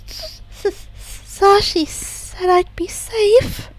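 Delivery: slow, stammering, tearful small voice; scared little girl clinging to her rescuer; frightened child. Acted. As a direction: whimper it out.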